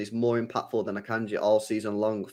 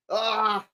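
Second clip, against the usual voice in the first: frustrated shout; 7 semitones higher than usual. Real, not acted. Frustrated at Stones' injuries.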